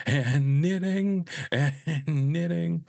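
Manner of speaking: high, slow, drawn-out repeated quote; mock meltdown, pitched well up; obsessive repetition. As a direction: repeat it high, stretching each round. Acting out an obsessive repeated line.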